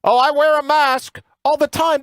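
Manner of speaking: high, whiny mock politician voice; a put-on pompous politician voice, pitched well up; mocking Chucky Schumer. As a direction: lampoon a senator's smug boast. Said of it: "Here he is".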